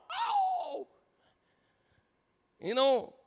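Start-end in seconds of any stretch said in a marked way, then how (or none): 0.1-0.9 high howling imitation of a dog; long high mock howl. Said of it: "My dog will start to howl".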